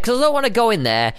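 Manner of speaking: ordinary delivery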